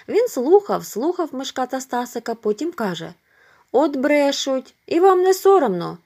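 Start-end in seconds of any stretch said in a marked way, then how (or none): 3.7-6.0 put-on higher and louder, scolding the boys; a neighbour boy indignantly scolds the liars; reproachful. A narrator's reading. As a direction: shame them for lying.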